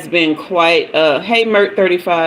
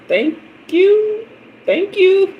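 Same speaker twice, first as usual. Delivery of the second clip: sweet thank-you; 13 semitones higher, slower than usual. Retold or imitated. Her reply to lady.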